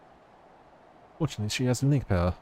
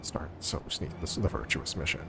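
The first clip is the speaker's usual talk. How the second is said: whisper; 8 semitones lower, 10 dB quieter than usual. Pretend. Sneaking mission.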